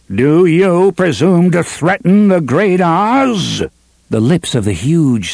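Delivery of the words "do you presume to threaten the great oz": a touch of tremble, slow and high; wavering, pompous threat; slightly trembling. A narrator's reading. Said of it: "spoke in a slightly trembling voice".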